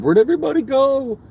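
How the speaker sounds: high, loud whiny voice; mock dismay. Streamer whining to the chat about viewers leaving, high and whiny. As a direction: wail it like a hurt cartoon.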